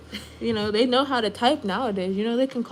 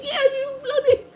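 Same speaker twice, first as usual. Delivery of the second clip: high, much louder mock British shout of bloody, played for laughs; joining the mock British yell; 5 dB louder than usual. Streamer mocking British people by shouting along. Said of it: "And I'm like".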